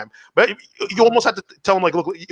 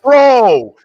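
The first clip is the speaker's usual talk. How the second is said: loud pushy bro voice, pitched up; mock hype shout; 3 semitones higher, 6 dB louder, much slower than usual. Friend urging him to go for it, loud bro shout. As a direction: egg your buddy on, loud. Buddy egging him on, retold.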